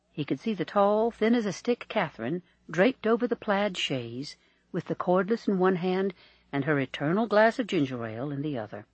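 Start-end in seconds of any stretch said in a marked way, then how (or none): none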